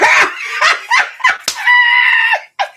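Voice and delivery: loud, high squealing laugh that bursts out; can't hold the laughter in; high shrieking laugh. Real, not acted. Laughing out loud at herself while fixing the stream, shrieking laugh. Laughing at her screen trouble.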